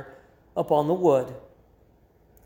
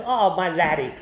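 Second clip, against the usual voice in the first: quick mock Scottish lilt on "Oh, my laddie"; Scottish accent; 3 semitones lower than usual. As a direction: toss off "Oh, my laddie" like a Scot. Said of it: "as a Scottish person says".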